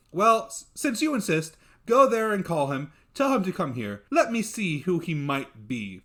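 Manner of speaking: a lady giving in but taking charge; commanding mistress. Acted. Mistress ordering her servant to fetch the lad, loud and brisk.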